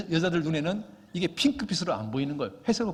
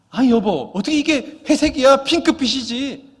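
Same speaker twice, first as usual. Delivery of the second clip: loud, higher, slowing on the last word; exasperated retort; 9 semitones higher, 11 dB louder than usual. Retold or imitated. Preacher loudly protesting to his wife, mock exasperated. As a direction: protest in disbelief, shouting.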